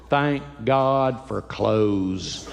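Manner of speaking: very slow, low, rueful; slow relieved deadpan. Retold or imitated. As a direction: drag it out with a sigh. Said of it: "what I think when I look in the mirror".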